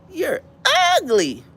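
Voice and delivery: high, drawn-out 'ugly', falling off; high-pitched mocking call; say it just like that. Shown as a demonstration. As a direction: squeal it high, then let it drop. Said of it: "just say".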